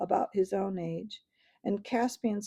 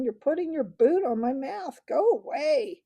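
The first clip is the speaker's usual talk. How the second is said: much higher, loud yelp; bellowed; 6 semitones higher, 5 dB louder than usual. Acted. Dwarf yells at the boy stepping on him, loud. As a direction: bellow it in pain. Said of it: "bellowed Trumpkin".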